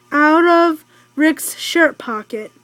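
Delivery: usual speaking voice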